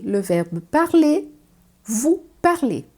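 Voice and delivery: Le verbe parler and vous parlez said slowly, same é ending; matching endings on parler and parlez; slow French demo. Shown as a demonstration. Teacher shows the infinitive and vous sound alike. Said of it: "tem a mesma pronúncia".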